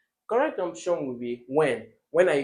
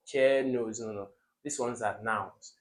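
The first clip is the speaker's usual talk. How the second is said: usual speaking voice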